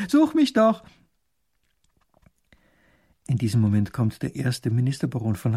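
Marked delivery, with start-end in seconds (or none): 0.1-0.8 high, playful sing-out; gleeful. Acted. Grand duke inviting a game of hide-and-seek, gleeful. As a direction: call it out high like a delighted child. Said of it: "freut sich der Großherzog".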